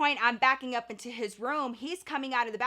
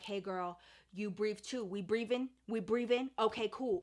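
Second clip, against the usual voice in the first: soft, lower breathing prompt; quiet gentle coaching; calming breath; 3 semitones lower, 5 dB quieter than usual. Retold or imitated. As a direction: coax her to breathe. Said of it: "I'm like".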